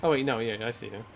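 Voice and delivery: usual speaking voice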